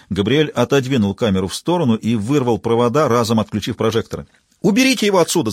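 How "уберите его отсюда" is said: shouting. Acted. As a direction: yell for him to be taken away. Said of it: "закричала Зоя".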